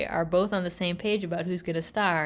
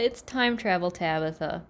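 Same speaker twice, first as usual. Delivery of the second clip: ordinary talk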